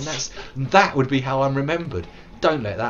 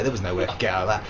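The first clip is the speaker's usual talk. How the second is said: ordinary delivery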